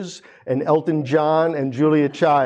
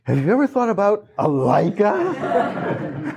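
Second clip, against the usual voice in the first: slow, higher sly temptation; tempting salesman; 6 semitones higher, slower than usual. Retold or imitated. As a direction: tempt him like the devil. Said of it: "just like Satan himself".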